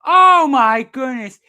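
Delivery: slow, high, loud drawn-out exclamation; disbelief. Real, not acted. Commentator watching the replay of a flop, slow loud disbelief. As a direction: stretch it out in total disbelief.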